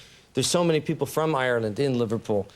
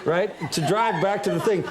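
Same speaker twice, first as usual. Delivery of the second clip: ordinary delivery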